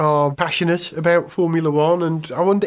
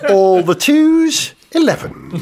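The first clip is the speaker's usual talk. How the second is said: slow loud drawn-out bingo call; bingo caller; 4 semitones higher, 5 dB louder, slower than usual. Pretend. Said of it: "bingo caller on acid".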